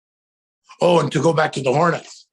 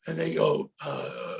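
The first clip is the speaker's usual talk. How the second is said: usual speaking voice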